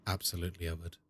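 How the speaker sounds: very low, hushed reply; mock-suave acceptance. Streamer accepting the developers' offer, mock-suave. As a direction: drop deep and soft, playing it smooth. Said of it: "And I was like".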